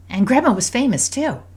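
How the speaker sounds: put-on high, eager child's question; curious daughter. A narrator's reading. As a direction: ask like an impressed little girl. Voices daughter asking about Grandma.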